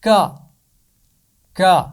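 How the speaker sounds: کا said twice, short and crisp from the back of the throat; sound demo. Shown as a demonstration. Teacher shows children where کا is made. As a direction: clip کا sharply, twice. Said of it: "اس کی آواز نکلے گی".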